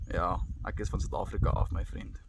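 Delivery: casual Afrikaans reply to a fellow South African. Real, not acted. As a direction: switch into easy Afrikaans. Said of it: "recognize the accent".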